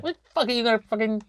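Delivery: a lightly put-on fed-up rapper. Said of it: "He was like".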